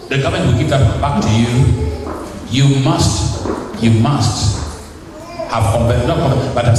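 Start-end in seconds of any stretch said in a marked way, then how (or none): none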